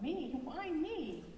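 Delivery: put-on high, bright kid voice; a puzzled little monster; surprised child. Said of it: "Mella shrugged".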